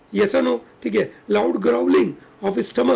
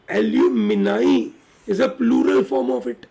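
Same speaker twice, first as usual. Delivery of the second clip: each word spaced out slowly for the class; slow and clear so students can note "Alumni is a plural form of it"; slow dictation; much slower than usual. Shown as a demonstration. Teacher dictating to the class, very slow and deliberate. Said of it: "याद रहेगा सबको".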